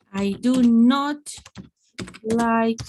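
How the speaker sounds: slow, loud "do not" in two full words; emphatic DO NOT. Shown as a demonstration. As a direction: hammer out "do not", word by word. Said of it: "if I don't contract, it is emphasizing".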